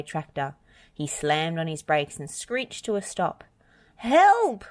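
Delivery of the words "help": high, loud panicked shout; roared cry for help. A narrator's reading. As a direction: cry out for rescue at full voice. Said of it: "roared Travis".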